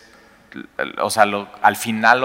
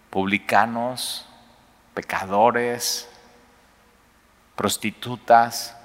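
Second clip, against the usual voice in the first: enumeración lenta; much slower than usual. Shown as a demonstration. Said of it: "enumera lentamente".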